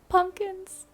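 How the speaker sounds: a high delighted squeal; excited squeal. Real, not acted. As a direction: burst out thrilled after the whisper. Bursts out after whispering pumpkins.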